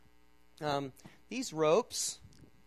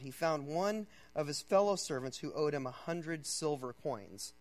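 ordinary delivery

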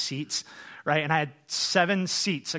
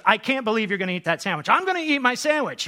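loud, high, rising mock quarrel; retold row building fast to a high yell; exploding argument; 7 semitones higher, 5 dB louder than usual. Husband and wife exploding over a sandwich, mock-furious. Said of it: "We just exploded suddenly".

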